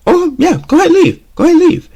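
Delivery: a parent daring you to try it; sarcastic parent. Retold or imitated. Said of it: "change in the pitch, in the voice".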